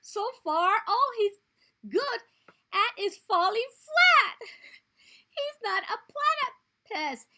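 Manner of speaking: high, loud mocking taunts; teasing. Skink taunts the platypus, loud and jeering. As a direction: jeer at him. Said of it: "he teased".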